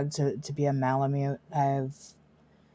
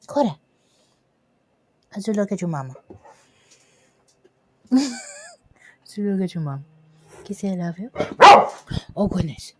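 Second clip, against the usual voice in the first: pet talk; 4 semitones higher, 6 dB louder than usual. Real, not acted. Owner coaxing her dog Cora to say I love you. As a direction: coax the dog sweetly.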